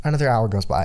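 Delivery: usual speaking voice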